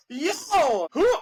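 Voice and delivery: high, laughing cry of disbelief; incredulous high yelp; shocked exclamation. Real, not acted. Shocked at hitchhiker story.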